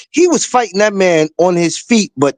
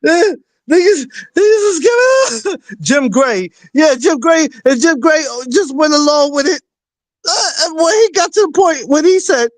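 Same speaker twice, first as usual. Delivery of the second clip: laughing while talking; 8 semitones higher than usual. Real, not acted. Laughing while telling story.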